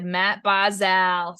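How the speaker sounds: put-on Matt Barzal in a slow, thick Boston accent. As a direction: drawl the name like a Bostonian. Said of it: "he said it in this thick Boston accent".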